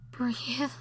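usual speaking voice

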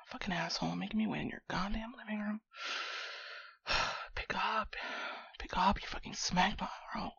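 muttered grumbling. Acted. Alone in friend's living room phoning him, muttering annoyed. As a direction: curse under your breath, quick and quiet.

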